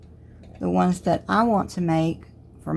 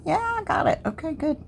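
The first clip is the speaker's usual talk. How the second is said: relieved high yelp; 10 semitones higher, 3 dB quieter, faster than usual. Real, not acted. Relieved after counting stripes.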